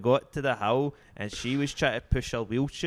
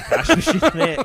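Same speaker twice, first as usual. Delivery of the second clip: laughing; 12 dB louder than usual. Retold or imitated. Retelling his reply to the robber.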